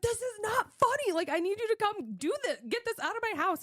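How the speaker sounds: very high-pitched indignant protest; retold exasperation. Daughter to dad laughing on the phone, exasperated. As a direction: reenact pleading crossly for help.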